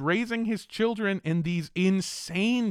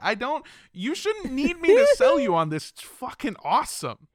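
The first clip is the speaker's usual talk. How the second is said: loud, high hyped-up pitch; hyped sales pitch; 9 semitones higher, 6 dB louder than usual. Pretend. Host hyping the sponsor tote to listeners, loud and high. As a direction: oversell it like a TV salesman.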